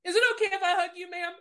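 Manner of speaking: high, fast, polite soldier's voice; imitating the soldier. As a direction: ask it quickly, pitched far up. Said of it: "And she goes".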